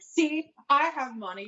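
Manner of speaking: high, drawn-out imitation of an indignant customer; mock yelling. Server imitating an angry customer showing her money, mock yelling. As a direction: mimic her protest high and slow, not really screaming. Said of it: "she starts screaming at me".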